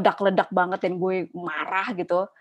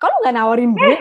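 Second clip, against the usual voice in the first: indignant retort; 3 semitones higher, 9 dB louder, faster than usual. Retold or imitated. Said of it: "Terus kayak".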